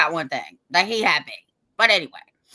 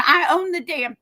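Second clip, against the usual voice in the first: defiant boast; 8 semitones higher, 4 dB louder than usual. Retold or imitated. Defiant retold boast, rising.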